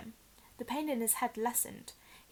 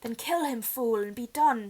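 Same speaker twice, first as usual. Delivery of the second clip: furious master screeching at servant; 6 dB louder than usual. Acted. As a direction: screech the kill order. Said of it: "screeched Voldemort".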